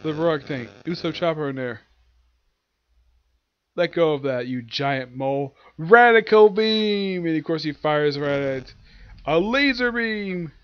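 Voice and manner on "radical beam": loud, slow, drawn-out shout of the attack name; attack callout. Acted. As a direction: call out the move like an anime hero.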